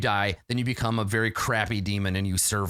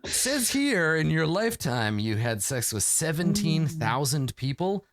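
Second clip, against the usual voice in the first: gatekeeper voice; 6 semitones higher than usual. Pretend. Heaven gatekeeper reading record.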